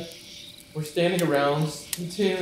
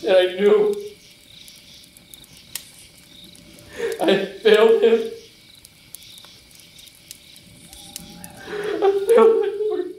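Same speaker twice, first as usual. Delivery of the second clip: anguished; 5 semitones higher, 4 dB louder than usual. Acted. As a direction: voice breaks high and loud on the last words. Peter realizes his betrayal.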